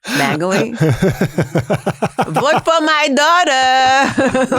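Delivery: high, very slow sing-song mom plea; mock campaigning mom. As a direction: drag it out like a mom begging votes. Impersonating her mom campaigning.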